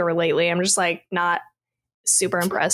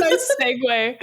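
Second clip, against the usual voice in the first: teasing through laughter; laughing; 14 semitones higher, slower than usual. Real, not acted. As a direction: laugh out a jokey compliment. Teasing the host's plug.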